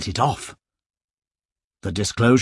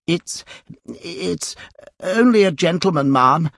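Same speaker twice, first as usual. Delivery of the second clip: Pickwick stammering; 7 semitones higher than usual. A narrator's reading. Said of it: "said Mister Pickwick from behind the curtains".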